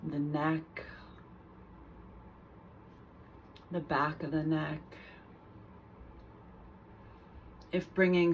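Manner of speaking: calm guided meditation with long pauses between phrases; guided body scan. Real, not acted. Teacher guiding listeners through a mindful body scan, unhurried meditation voice. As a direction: lead them gently through the body, leaving space after each phrase.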